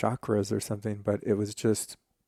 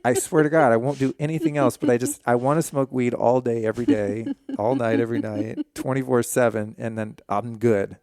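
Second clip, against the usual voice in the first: loud, eager begging to the heavens; mock pleading prayer; 3 semitones higher, 6 dB louder than usual. Man hams up his prayer to Sai Baba, loud begging. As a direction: beg the saint for weed, half joking. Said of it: "So my prayer was like".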